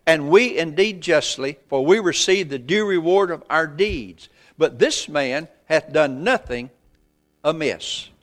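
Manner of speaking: loud, slow preacher's scripture reading; preaching scripture. Acted. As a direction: thunder the verses out to the church. Reads scripture at full force.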